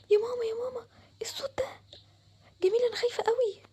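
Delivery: high, scared whisper; frightened whisper. Acted. Girl hearing the ghost again, terrified whisper. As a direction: gasp it out in terror.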